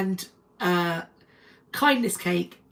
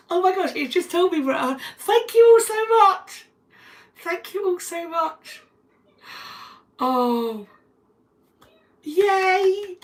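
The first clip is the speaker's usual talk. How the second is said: high, loud, fast thanks; thanking everyone with an excited shout; real overjoyed shouting; 11 semitones higher, 8 dB louder than usual. Real, not acted. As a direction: shout your thanks in pure joy. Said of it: "I'm really excited".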